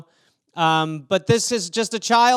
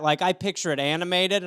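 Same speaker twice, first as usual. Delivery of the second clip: ordinary talk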